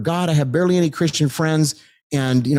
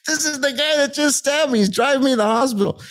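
much higher, still half laughing; amazed disbelief; 13 semitones higher than usual. Real, not acted. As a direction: say it like you can't believe it yourself. Marvels the stabber drove him.

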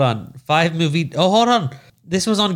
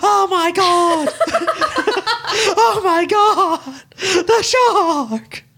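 high, slow, panicky mock cries; mock panicked woman; slower than usual. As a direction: squeal like the victim, laughing. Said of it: "And she's like".

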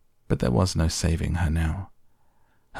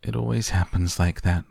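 ordinary delivery

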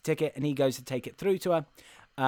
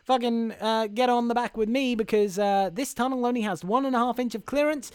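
put-on American accent, urgent and pitched up; Tom Hanks's hobo; 6 semitones higher than usual. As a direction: call out as a hammy Boston-New York drifter. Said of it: "'cause he's like".

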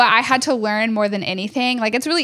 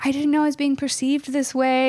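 upset; 4 semitones higher than usual. Retold or imitated. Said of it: "she was just so upset".

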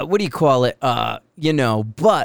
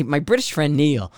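ordinary talk

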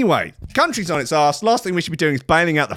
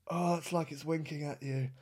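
much softer, drawn back repeat; softer aghast echo; 5 semitones lower, 17 dB quieter than usual. Shown as a demonstration. Disgusted viewer repeating it to himself, much softer. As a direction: say it again under your breath. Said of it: "repeated softer".

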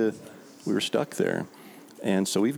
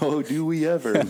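sarcastic; 7 semitones higher than usual. Retold or imitated. Hunter mocks the drunk's offer, sarcastic. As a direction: overplay the eagerness. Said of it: "I'm like".

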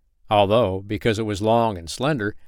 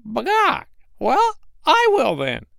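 high, slow, weary hen squawk; a tired hen giving in, slow and much higher; discouraged hen; 4 dB louder, slower than usual. Acted. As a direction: sigh it out like a let-down hen. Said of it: "rather discouraged".

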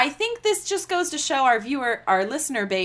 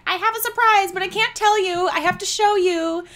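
excited teasing voice; 9 semitones higher, 4 dB louder than usual. Retold or imitated. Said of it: "Ashley told me on New Year's".

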